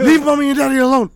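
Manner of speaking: put-on high, loud, quick kid blurting it out; mock child's voice, loud and high. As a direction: rush it out like an excited little boy. Son voiced, playing wingman.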